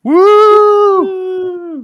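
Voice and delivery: loud high party whoop; rowdy party animal. Real, not acted. Party cheer for new podcast.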